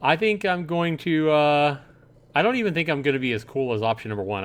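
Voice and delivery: usual speaking voice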